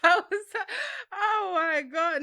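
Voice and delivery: breathless, still laughing; gasping through giggles; after laughing hard. Real, not acted. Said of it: "Let me compose myself".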